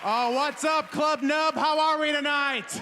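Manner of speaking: loud, high shout to the audience; big stage-entrance holler; hyped crowd greeting. Real, not acted. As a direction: call out to fire up the club.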